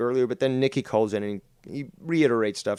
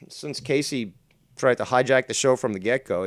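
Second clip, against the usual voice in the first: normal talking voice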